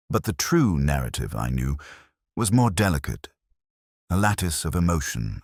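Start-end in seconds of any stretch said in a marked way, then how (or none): none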